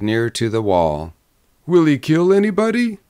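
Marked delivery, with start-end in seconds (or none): 1.7-3.0 high, nervous question; anxious drummer. A narrator's reading. Drummer sidled to the wall, asking again, nervous. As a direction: ask it fearfully, pitched well up.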